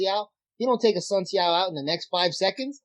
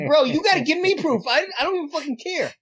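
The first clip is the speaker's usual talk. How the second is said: high, loud exasperated bro rant; mock-yell at a friend demanding proof; heated retold rant; 8 semitones higher, 3 dB louder than usual. Retelling an argument with Hector.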